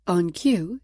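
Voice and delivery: usual speaking voice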